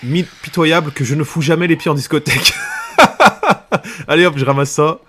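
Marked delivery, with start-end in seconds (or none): 2.6-4.0 sudden high-pitched laugh. Real, not acted. Laughs at own vocabulary.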